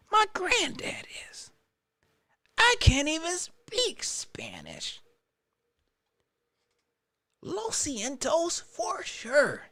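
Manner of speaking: high, soft, sheepish put-on voice; playing Bryce. Host voices Bryce denying he speaks Spanish, high and meek. Voicing Bryce Young's reaction.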